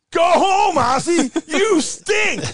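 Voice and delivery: high, loud mock American jeers; jeering crowd. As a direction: shout like an angry fan booing from the stands. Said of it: "the grandstand are going".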